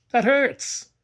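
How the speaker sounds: high, loud cry of pain; the boy yells as she touches his back; scream. A narrator's reading. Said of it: "he screamed".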